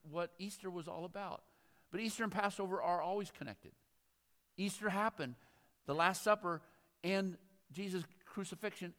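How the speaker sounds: normal talking voice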